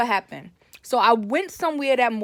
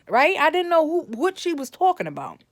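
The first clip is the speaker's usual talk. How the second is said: ordinary delivery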